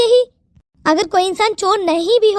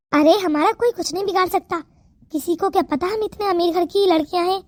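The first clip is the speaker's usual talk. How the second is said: ordinary talk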